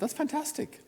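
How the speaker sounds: excited. Retold or imitated. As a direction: burst out with joy. Excited reply to friend's news.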